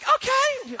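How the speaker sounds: put-on high, light girlish okay; daughter agreeing. Daughter cheerfully agreeing to cheer for her brother. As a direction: chirp it like a happy little girl. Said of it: "So she's like".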